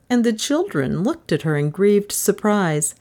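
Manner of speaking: ordinary delivery, nothing marked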